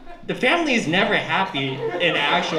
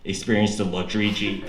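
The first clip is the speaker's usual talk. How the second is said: car ad voice; 8 semitones lower than usual. Pretend. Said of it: "they should advertise it like this".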